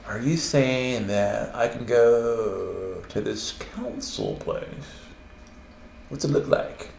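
slow, hushed, drawn-out questioning; mock dubious questioner. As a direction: feel your way doubtfully through the question. Voices a doubtful listener.

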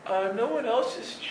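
high-pitched reluctant objection; mock protest. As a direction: act out a feeble, pleading excuse. Retells protesting to the inner voice.

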